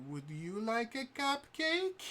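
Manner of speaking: put-on high, sugary girlish offer; sweet Jester voice. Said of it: "she's like".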